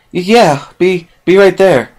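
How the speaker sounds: a startled, stuttering reply; flustered stammer. Acted. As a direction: stammer as if caught off guard. Startled by a knock.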